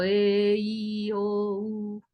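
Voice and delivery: "e, i, o, u" each held steady; sustained vowels. Shown as a demonstration. Teacher showing vocal cords vibrate, drawn-out vowels. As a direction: draw out each of "e, i, o, u" evenly. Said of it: "Cuando yo digo a, e, i, o, u".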